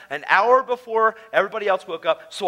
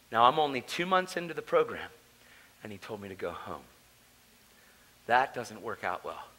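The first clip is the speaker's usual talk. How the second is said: usual speaking voice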